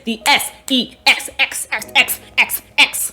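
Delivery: slow, chopped letter by letter; punchy letters with comic gaps; hammed-up spelling out. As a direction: spell it out slowly, stuttering on the last letter.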